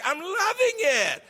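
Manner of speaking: high, slow, laughing with joy; laughing delight. Real, not acted. Telling the audience about studying French, laughing with delight. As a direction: let the joy spill over into a laugh.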